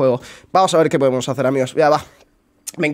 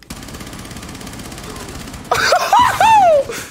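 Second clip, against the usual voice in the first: high, airy wheezing laugh with no voice; real wheezing laughter; breathless laugh. Real, not acted. Laughing at enemy.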